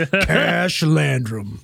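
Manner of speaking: very slow, drawn-out hero name; slow detective voice. Pretend. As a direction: stretch it out like a movie trailer. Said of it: "Sounds like a fucking detective".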